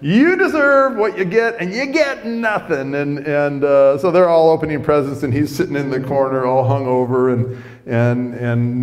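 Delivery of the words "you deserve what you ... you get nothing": high, loud mock scolding; hammed-up indignant telling-off, voice pitched way up; angry family. Family denying the son his Christmas presents, mock-angry. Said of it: "so angry at him".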